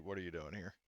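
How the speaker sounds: normal talking voice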